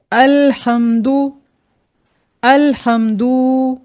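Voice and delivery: अल्हम्दु with the dal drawn out, slowly; wrongly stretched. Shown as a demonstration. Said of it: "दाल को हमने खींच कर पढ़ दिया". (अल्हम्दु में दाल को खींचकर)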